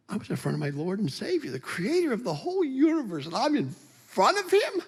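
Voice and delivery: very high, squeaky disbelief; acted awestruck, voice way up high; overwhelmed awe. Acting overwhelmed before his Lord.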